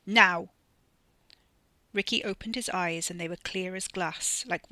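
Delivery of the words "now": sudden loud cry; shout. A narrator's reading. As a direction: call it out sharply. Wes finds his voice, shouts.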